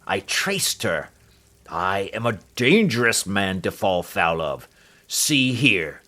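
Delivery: loud warning in a put-on heavy voice; menacing threat. The doctor threatens Holmes to stay out, menacing. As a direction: warn him off with menace.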